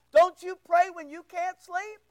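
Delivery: ordinary talk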